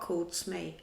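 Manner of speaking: usual speaking voice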